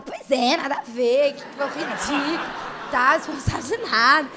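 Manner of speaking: loud scoffing brush-off; dismissive. Retold or imitated. Girl brushing off the rumor, loud and scornful. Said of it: "você fala".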